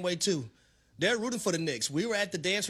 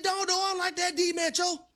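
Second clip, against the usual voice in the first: laughing fan yelling at the television; 9 semitones higher than usual. Retold or imitated. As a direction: holler at the player on TV. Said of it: "I said".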